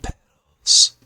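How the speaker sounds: unvoiced breathy whisper; ASMR whisper. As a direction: whisper it into the mic.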